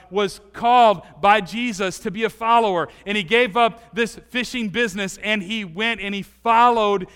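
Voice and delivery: ordinary talk, nothing marked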